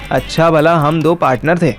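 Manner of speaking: normal talking voice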